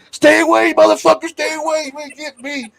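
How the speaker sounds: high frantic scream fading out; swinging grocery bags at a possum, high mock scream; panicked scream. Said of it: "I was screaming".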